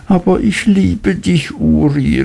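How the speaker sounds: high, pleading cry of love; mother crying out. A narrator's reading. Mrs Heep crying out to her son, high pleading. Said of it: "rief Misses Heep".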